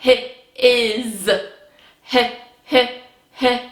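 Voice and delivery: H said as a breathy h sound. Shown as a demonstration. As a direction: stretch out the h in his. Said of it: "here we go".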